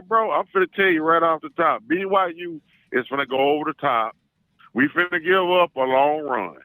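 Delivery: ordinary delivery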